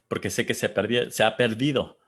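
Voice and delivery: ordinary talk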